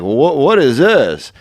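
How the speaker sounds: very slow, baffled question; slow puzzled Norm. Retold or imitated. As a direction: puzzle over it slowly, like it's alien. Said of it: "he'll be like".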